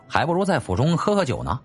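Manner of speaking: ordinary delivery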